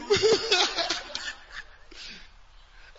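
loud high-pitched laughing burst. Acted. Widow laughing at the shared secret, high and loud.

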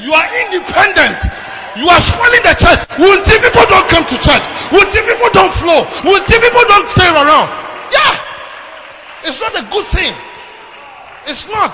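loud, high, shouted sermon; fiery preacher rant; preaching at full force. Acted. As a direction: rebuke the church at full volume.

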